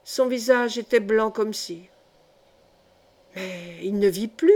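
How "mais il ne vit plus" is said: understated shocked cry, leaping up at the end; exclaimed alarm. A narrator's reading. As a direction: exclaim in startled disbelief. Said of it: "s'écria le moine". (exclamation stupéfaite, sans crier)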